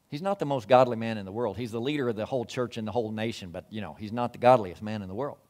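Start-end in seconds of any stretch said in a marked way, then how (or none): none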